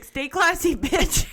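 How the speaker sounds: high mock-sassy Stay classy; sassy sign-off. Aunt teasing her niece at call's end, sassy. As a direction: tease it out, laughing. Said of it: "she's like".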